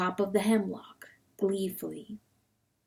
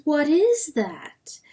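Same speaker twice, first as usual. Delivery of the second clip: higher, slow questioning; dream voice; 7 semitones higher, slower than usual. A narrator's reading. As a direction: intone the question high and slow.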